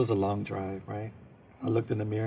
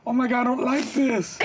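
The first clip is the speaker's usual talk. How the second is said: mock scream; 12 semitones higher, 5 dB louder than usual. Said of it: "I was screaming".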